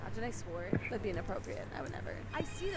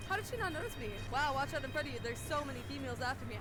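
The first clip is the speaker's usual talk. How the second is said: ordinary talk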